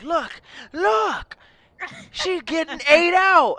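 shrieking high and loud; excited high shriek. Retold or imitated. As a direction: squeal it like a thrilled kid. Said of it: "And Anissa's like".

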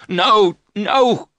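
loud, high, desperate denial; anguished cry. Acted. Jonathan crying out at his wife's words, loud. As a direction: refuse to believe it, crying out. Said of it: "Jonathan cried".

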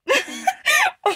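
high, loud laughing fit; burst of laughter. Real, not acted. Vlogger cracking up after friend says hi, high laugh. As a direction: crack up loudly in a high squeal.

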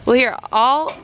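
ordinary talk

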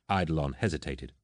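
plain reading voice

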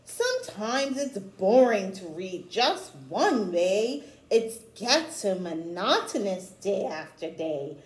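rhythmic read-aloud of a rhyming picture book; storybook reading. Acted. Teacher reading a rhyming book to children, read-aloud style. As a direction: read the rhymes to children with a gentle lilt.

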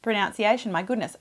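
ordinary talk, nothing marked

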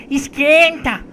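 catchphrase shout. Acted. Grito de bordão.

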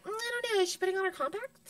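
high, confused society voice; puzzled posh crowd. Retold or imitated. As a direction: play a baffled Beverly Hills lady. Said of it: "Beverly Hills is like".

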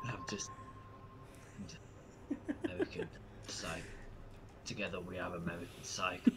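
soft, low, halting delivery; brooding movie line. Acted. Actor saying the title in the movie, mock-serious murmur. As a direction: pause between words, low and grave.

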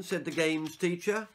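usual speaking voice

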